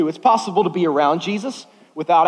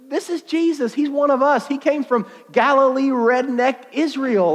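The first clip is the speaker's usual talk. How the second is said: high-pitched, incredulous local; hammed-up high disbelief; scoffing townsfolk; 9 semitones higher than usual. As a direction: protest that he's just a local, pitched high. Crowd acted, scoffing at local man.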